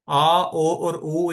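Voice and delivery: slow, each umlaut vowel drawn out. Shown as a demonstration. Teacher sounding umlaut vowels for learners, slow. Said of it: "इसके ऊपर अमलाउट है".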